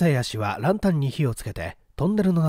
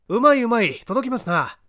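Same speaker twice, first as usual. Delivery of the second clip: higher, louder delighted approval; pleased praise; 9 semitones higher, 4 dB louder than usual. Acted. The detective praising the man's reach. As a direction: cheer him on warmly.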